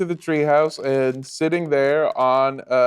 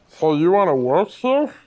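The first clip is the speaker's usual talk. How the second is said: wheezy heavyset boss, slightly raised pitch; heavy-breathing editor; 4 semitones higher, 4 dB louder than usual. Acted. Editor to the young job applicant, breathing heavily. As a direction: heave a big breath, then ask. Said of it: "breathes heavily and goes".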